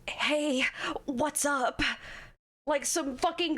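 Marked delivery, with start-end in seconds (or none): 0.1-1.8 whispery, timid anime-guy imitation; mock a shy guy with a breath of whisper; shy dub-anime guy. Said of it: "shy, like, dub anime guy".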